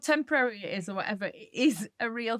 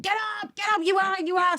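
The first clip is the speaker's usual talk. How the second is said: higher, shouting get up; urgent shout; 9 semitones higher, faster than usual. Retold or imitated. Mother urging her daughter onto the coach, urgent shout. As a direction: yell at her to get moving. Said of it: "And I said".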